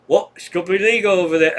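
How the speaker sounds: old hippie quoted in a bad Irish accent; failed Irish accent. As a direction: attempt an Irish accent and miss it. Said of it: "That's not an Irish accent".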